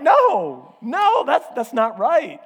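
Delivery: high, loud, laughing objection; laughing protest. Retold or imitated. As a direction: laugh it off in mock outrage. Protesting his wife's flippant reply.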